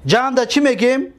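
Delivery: usual speaking voice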